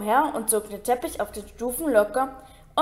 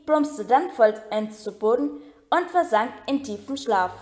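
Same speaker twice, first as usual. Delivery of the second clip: plain reading voice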